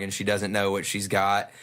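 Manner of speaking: ordinary talk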